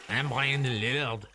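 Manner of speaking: put-on light, uncertain Scottish accent; hint of a mock Scottish accent; attempted Scottish accent. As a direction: have a rough go at a Scottish accent. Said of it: "a Scottish person can say Lillard".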